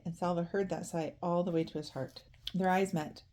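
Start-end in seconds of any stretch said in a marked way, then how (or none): none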